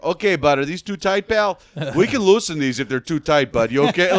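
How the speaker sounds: overly nice as he cuffs you; friendly Canadian cop. Retold or imitated. Canadian border officer to the man he arrests, chummy and kind. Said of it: "they were so Canadian about it".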